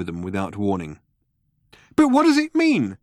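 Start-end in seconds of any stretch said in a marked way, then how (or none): 1.9-3.0 high, loud baffled cry. A narrator's reading. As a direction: cry it out, pitch leaping up. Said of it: "cried Arthur".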